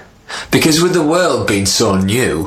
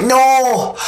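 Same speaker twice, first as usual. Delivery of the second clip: spider. Acted. Spider answers the king.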